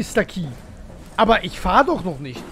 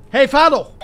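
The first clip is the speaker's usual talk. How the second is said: loud, exasperated order to get moving; impatient shout; 3 semitones higher, 8 dB louder than usual. Real, not acted. Gamer to a train that won't start, impatient shout. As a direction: yell at the stubborn train.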